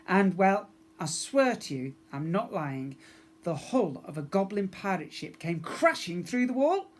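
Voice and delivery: ordinary delivery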